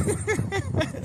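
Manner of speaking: a short high giggle. Real, not acted. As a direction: let a high laugh slip out. Laughing at a flattering comment.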